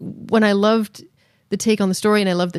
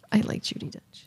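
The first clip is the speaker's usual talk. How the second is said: soft whispered aside; 10 dB quieter than usual. Real, not acted. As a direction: breathe it under the voice.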